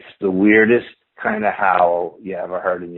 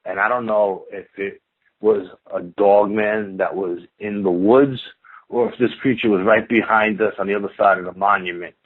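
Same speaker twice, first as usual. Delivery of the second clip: ordinary delivery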